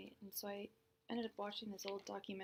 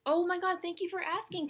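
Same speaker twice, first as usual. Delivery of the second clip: high, loud burst of thanks; excited; 8 semitones higher, 10 dB louder than usual. Real, not acted. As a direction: gush out the thanks at full volume. Excited reply to chat question.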